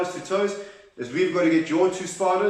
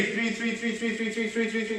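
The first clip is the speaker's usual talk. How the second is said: higher, softer, quick stuttering chant; rapid-fire repeated count; 5 semitones higher, 5 dB quieter than usual. Pretend. Coach counting as the spider scurries, rapid playful chant. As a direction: patter the number like scurrying spider legs.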